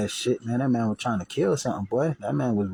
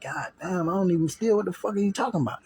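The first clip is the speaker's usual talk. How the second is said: much higher, pleading disbelief; panicked protest; 9 semitones higher than usual. Retold or imitated. Teen with a shotgun on his chest, high panicked denial. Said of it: "I'm like".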